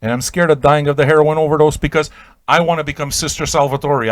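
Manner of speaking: usual speaking voice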